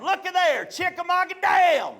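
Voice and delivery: put-on high, loud kid exclaiming; excited kid. Boy pointing out the dam sign to parents, high excited exclaim. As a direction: show off the sign with glee. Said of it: "I said it out loud".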